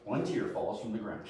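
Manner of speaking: low, quiet imitation of a dad; stern dad. Dad scolding his son over a splinter, low mock sternness. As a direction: drop the voice as an unimpressed father. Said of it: "And my dad going".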